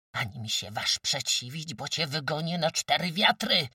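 threat building to a high, loud cry; angry shout. A narrator's reading. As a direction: raise your voice as the threat mounts. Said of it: "krzyknęła". (gniewny, narastający krzyk)

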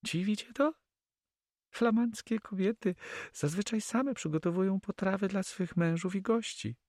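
very high female character voice; Flemish girl, proud hostess. Acted. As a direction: explain it in a high lady's voice. (bardzo wysoki głos młodej damy)

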